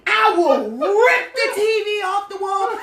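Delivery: mock furious husband. Husband threat repeated.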